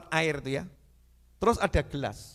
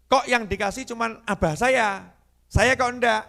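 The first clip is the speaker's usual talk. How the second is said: raised, higher questioning voice; daring protest; 5 semitones higher, 5 dB louder than usual. Retold or imitated. Guest asking Habib why only father got some, boldly. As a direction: speak up boldly with a complaint. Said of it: "saya nekat ngomong tuh".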